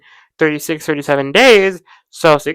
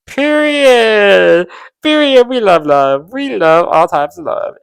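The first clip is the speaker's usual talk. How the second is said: emphatic cheering; 3 semitones higher, 8 dB louder, slower than usual. Retold or imitated. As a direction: declare it proudly like a slogan.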